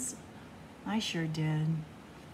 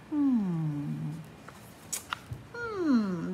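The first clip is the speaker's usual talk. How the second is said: plain reading voice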